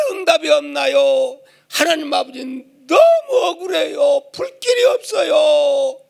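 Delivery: high tearful shouting plea; wailing complaint. Retold or imitated. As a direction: sob out the grievance to God. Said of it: "결국은 열받아가지고 하나님 앞에". (억울해서 하나님께 울며 소리치듯)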